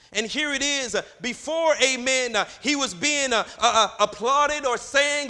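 loud, high-pitched sermon cadence; full-force preaching. Acted. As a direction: drive each short phrase out to the congregation, punctuated with "uh".

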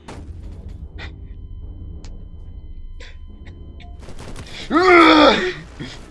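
long screeching wail; long high scream. Real, not acted. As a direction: howl it out in shock. Screaming while killed in game.

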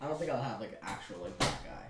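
quiet mumble. Real, not acted. Hurt friend mumbling low.

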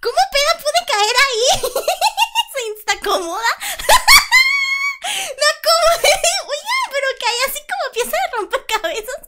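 high, loud words broken by laughter; crying with laughter; laughing while talking. Real, not acted. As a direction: speak through helpless laughs. Laughing at her fall.

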